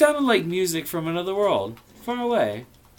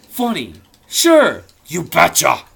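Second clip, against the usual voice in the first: sarcastic; 3 semitones higher, 13 dB louder than usual. Real, not acted. Sarcastic aside to text.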